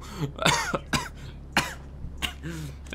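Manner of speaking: a rough, sick cough. Real, not acted. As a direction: cough like you have a cold. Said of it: "I'm a little, little sick".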